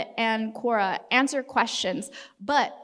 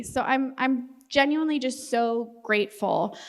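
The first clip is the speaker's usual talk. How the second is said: normal talking voice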